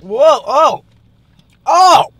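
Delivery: sudden loud high cry; jolted by the heat; startled yelp. Real, not acted. Spicy food hits hard.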